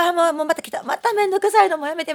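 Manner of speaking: mock mother waving off a tiresome kid; fed-up mother. Recalling her mother brushing off her odd questions, hammed-up exasperated sigh.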